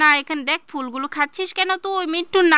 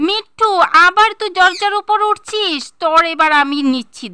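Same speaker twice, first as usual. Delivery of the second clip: angry shout; 3 semitones higher, 5 dB louder than usual. Acted. Mother catches son on the door again, loud angry shout. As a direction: catch him red-handed and let him have it.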